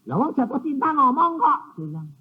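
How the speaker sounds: normal talking voice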